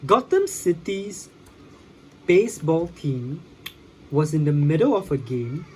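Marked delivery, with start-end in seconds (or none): none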